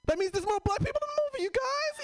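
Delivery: high, fast, near-crying glee; giddy excitement. Real, not acted. Telling the crowd the news, high fast giddy glee. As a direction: squeal it out overjoyed.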